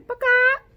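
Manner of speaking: high, loud hen cluck; playful mock bawk; hen squawk. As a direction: squawk like a chicken. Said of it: "I liked making the noises".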